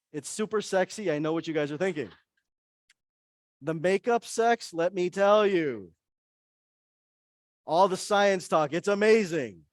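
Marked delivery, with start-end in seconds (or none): 3.6-9.7 sly joke. Real, not acted. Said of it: "joking aside about makeup sex".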